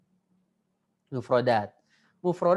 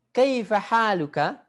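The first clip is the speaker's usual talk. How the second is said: model reading; 4 semitones higher, 4 dB louder than usual. Shown as a demonstration.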